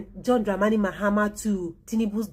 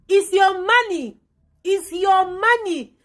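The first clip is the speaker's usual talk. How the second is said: high, loud, slow repeated yell; angry shout; 10 semitones higher, 6 dB louder, slower than usual. Real, not acted. Scolding Nigerians over the daughter's house, angry shout. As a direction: drive it home, furious.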